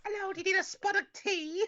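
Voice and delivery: very high, squeaky English tea-lady; hammed-up falsetto British voice; mock English accent. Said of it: "like, an English accent".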